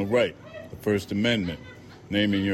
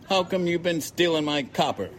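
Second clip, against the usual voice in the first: much higher, accusing cracker voice; 10 semitones higher than usual. A narrator's reading. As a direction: throw the accusation at him. Narrator voices the accusing man.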